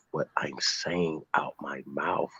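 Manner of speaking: slow, hushed whisper for emphasis. Real, not acted. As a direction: lean in and whisper it slowly. Emphatic aside about proof.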